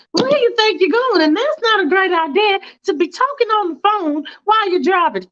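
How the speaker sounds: a prim passenger scolding the driver; Faith scolding shrilly. Acted. Faith nagging the driver on the phone, high and shrill.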